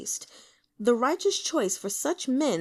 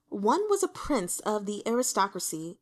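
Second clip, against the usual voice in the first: ordinary talk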